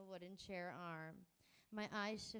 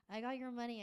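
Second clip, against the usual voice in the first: blurted out louder than meant; nervously overloud, a bit higher; awkward, too-loud blurt; 5 semitones higher, 7 dB louder, faster than usual. Acted. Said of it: "I say too loudly".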